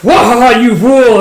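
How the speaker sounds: loud, high, drawn-out taunt; hammed-up villain outburst; gloating villain. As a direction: crow slowly and loudly over the fool. Villain reveals himself.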